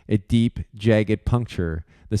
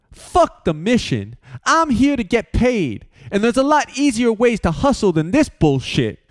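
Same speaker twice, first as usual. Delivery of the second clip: shouted retort; 13 semitones higher, 3 dB louder than usual. Acted. George shouting that he only wants his pay. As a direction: bark it at the whole crew. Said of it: "George shouted".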